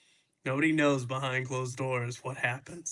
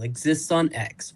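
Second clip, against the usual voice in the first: ordinary delivery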